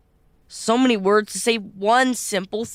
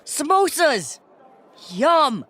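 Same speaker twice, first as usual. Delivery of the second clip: excited; 8 semitones higher, slower than usual. Acted. Thrilled at packed lunch.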